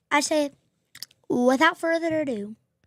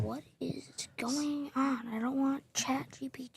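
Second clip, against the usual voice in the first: whispering; 9 dB quieter than usual. Real, not acted. Aside while the stream glitches.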